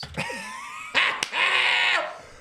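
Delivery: big high shouting laugh; loud laugh. Real, not acted. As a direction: burst out laughing loudly. Loud burst at stream.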